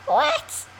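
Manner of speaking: very high, astonished "What?"; can't believe it; hearing people knit these in a day, high disbelief; incredulous what. Real, not acted. Reacting to knitting in a day.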